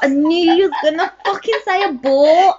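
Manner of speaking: excited outburst. Real, not acted. Guessed the friend's answer.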